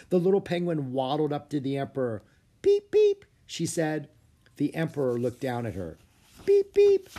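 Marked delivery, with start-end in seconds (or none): 2.6-3.2 very high loud chirped beep; baby penguin beeps. Acted. Baby penguin calling to the emperor, high chirping beeps. 6.4-7.1 very high loud chirped beep; baby penguin beeps. Acted. Baby penguin calling to the emperor, high chirping beeps.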